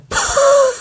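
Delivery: short high-pitched laugh; incredulous laugh. Real, not acted. Son told the whole family needs counseling, incredulous laugh. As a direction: let out a brief, high laugh of disbelief. Scoffing after parents' counseling remark.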